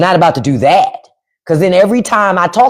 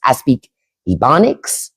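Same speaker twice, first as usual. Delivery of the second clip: ordinary delivery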